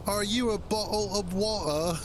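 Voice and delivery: hammed-up British accent aimed at a stranger; mock British accent. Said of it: "instead you walk up to them and go".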